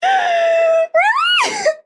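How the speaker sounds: very high, laughing through tears; overjoyed cry-laugh. Real, not acted. Streamer thanking chat for the gift, crying with joy. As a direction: squeal with disbelief and joy.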